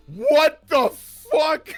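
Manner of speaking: slow stunned shout; shocked guard yell. Acted. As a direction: blurt it in disbelief. Guard shocked by octopus melt.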